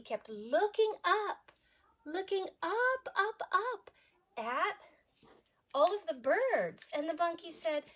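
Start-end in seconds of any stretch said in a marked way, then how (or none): none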